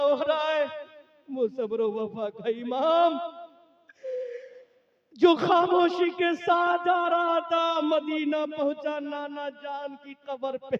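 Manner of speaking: high, drawn-out oratory swelling to a shout; impassioned preaching. Acted. Preacher telling of Husain's head reaching Medina, impassioned lament. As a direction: cry out the sacred tale, stretching the phrases. Preacher narrates at full force.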